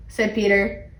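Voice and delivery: usual speaking voice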